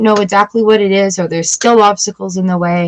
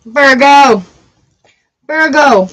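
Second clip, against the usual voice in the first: loud, higher call; calling out Virgo; 4 semitones higher, 4 dB louder, slower than usual. Real, not acted. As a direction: announce the sign with excitement. Calling out sign, excited.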